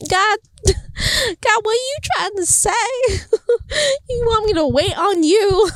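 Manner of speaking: high, tearful half-laugh to God; teary giggling plea; laughing through tears. Retold or imitated. Laughing-crying prayer to God.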